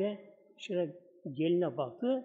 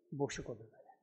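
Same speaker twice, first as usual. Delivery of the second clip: much softer, almost breathed repeat; hushed echo; 6 semitones lower, 13 dB quieter, faster than usual. A narrator's reading. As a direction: let it fade into emptiness. Soft echo of previous phrase. (fısıltıya yakın, çok alçak yankı)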